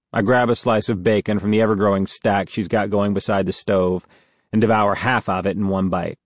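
ordinary delivery, nothing marked

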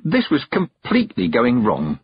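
ordinary delivery

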